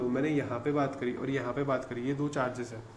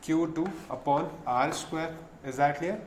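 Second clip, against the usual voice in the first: ordinary talk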